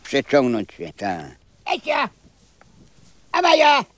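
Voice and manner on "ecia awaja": high, loud shout to chase a dog off; calling a dog. Real, not acted. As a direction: yell at the dog to scram. Old man shooing a dog.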